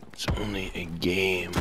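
low, slow, very soft; self-soothing murmur. Real, not acted. Gamer repeating it's only a game, hushed and slow. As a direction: murmur it slowly to calm down. Calming self after rage.

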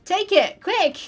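high, loud hurried call; urgent calling out. Real, not acted. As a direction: shout it to a friend with no time left. Urgently calling streamer to take raid.

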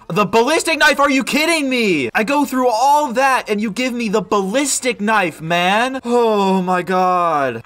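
real outrage at the box; outraged disbelief. Real, not acted. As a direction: protest at the rotten luck. Bad mystery box roll.

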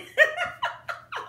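breathy high-pitched giggle; a burst of high laughter. Real, not acted. Laughs at her own bodysuit confession.

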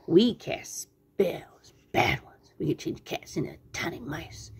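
slow, spooky stage whisper; menacing witch whisper. Acted. Tweety Witch threatening cats with spells, spooky whisper. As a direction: whisper the threat, stretching the first words.

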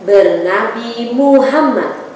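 strong, rhythmic recited pledge; declamatory pledge. Acted. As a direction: proclaim each line firmly. Leads pledge and prayer.

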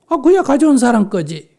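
high, loud, impatient answer; imitating an irritated man; impatient retort. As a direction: act out stating the obvious crossly. Angry man answers the obvious.

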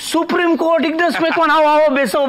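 high, excited repeating of the title; impressed, eager welcome; flustered welcome. Acted. Flustered welcome to important visitor.

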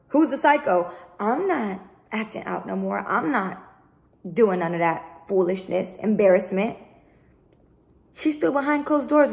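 usual speaking voice

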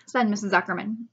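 plain reading voice

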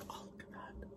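faint, breath-only whisper; awed whisper. Real, not acted. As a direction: whisper in awe at the sight. Overcome by beignet picture.

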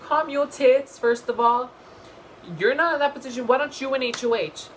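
high, loud sassy retort; sassy comeback. Retold or imitated. Vlogger sassing Paul back, mock telling off. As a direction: tell him off with attitude. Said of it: "I was like".

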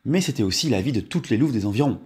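ordinary delivery, nothing marked

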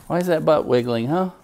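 talking to the dog in pet voice. Real, not acted. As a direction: coo at the dog's wiggling butt. Baby-talking to wiggling dog.

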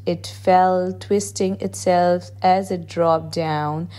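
ordinary delivery

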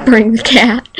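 ordinary talk, nothing marked

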